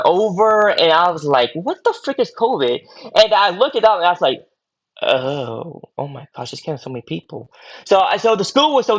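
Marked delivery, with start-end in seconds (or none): 1.5-2.8 higher-pitched mock bewilderment; incredulous, puzzled retelling; retold bafflement. Quotes own puzzled reaction. 5.0-7.5 hushed, low, sinking realization; quiet, stunned quote of himself; retold dismay. Quotes own dismay at covid deaths.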